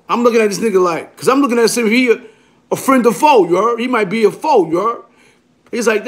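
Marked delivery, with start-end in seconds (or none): none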